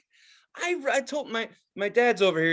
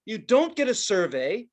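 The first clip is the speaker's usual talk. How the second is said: usual speaking voice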